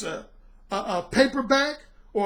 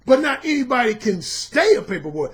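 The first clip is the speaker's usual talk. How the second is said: normal talking voice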